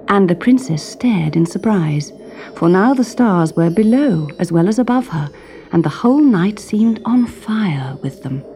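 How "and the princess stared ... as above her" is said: ordinary delivery, nothing marked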